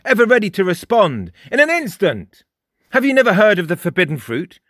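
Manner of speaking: put-on high, loud, cocky boy's voice; mocking Frost. A narrator's reading. Frost lectures Jake, high and loud, mocking. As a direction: needle him with a sneer.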